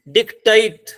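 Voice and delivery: Dictate with the second syllable stressed, loud and high; stress on last syllable. Shown as a demonstration. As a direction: lean on the end syllable of dictate. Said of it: "last पे लगेगी". (Dictate, आख़िरी syllable पर ज़ोर)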